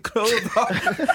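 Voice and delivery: usual speaking voice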